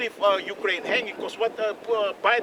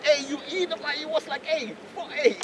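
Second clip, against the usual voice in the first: retold angry outburst; 13 semitones higher than usual. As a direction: blow up at the reporter. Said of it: "he flipped out".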